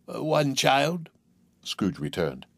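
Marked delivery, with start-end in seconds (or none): 0.1-1.1 slow, reluctant reply; hesitant. A narrator's reading. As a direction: drag it out reluctantly.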